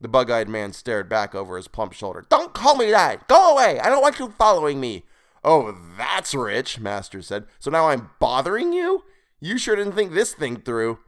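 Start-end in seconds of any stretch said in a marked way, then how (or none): 2.3-5.0 high, very loud, petulant yelling; irate creature shout. A narrator's reading. Creature fleeing his pursuer, irate shout. 5.4-6.8 slow, drawn-out scornful retort; sarcastic. A narrator's reading. Captive answering his captor's complaint, sarcastic. 7.6-11.0 high-pitched, incredulous taunt; indignant sarcasm. A narrator's reading. Captive mocking his captor's complaint, indignant.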